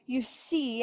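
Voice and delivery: ordinary talk, nothing marked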